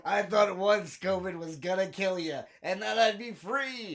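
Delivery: hammed-up doctor, rising to a cry; acting out the weary doctor. Doctor voiced in a comic bit.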